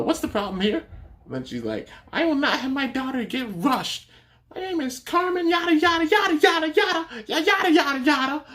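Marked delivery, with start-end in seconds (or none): 0.0-0.8 high-pitched desk clerk question; lightly acted, high clerk voice; imitating receptionist. 2.1-8.4 very high, indignant mock rant; exaggerated high-pitched tirade; imitating yelling woman. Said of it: "then she starts, like, yelling".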